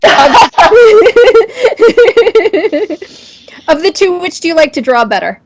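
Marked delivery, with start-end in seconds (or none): 0.2-3.0 big burst of laughter. Real, not acted. Host laughing at the guest's bicycle answer. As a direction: crack up at the answer.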